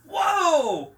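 high, loud yelp into hasty apology; yelp, then back down comically; mock startled. Podcaster retelling Carter meeting a huge man, mock startled.

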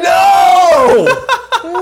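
huge, high drawn-out wail of "no"; mock anguished shout. Co-host acts his horror at getting the job, mock anguished shout. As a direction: cry out in comic horror. Acts out friend's dismay at casting.

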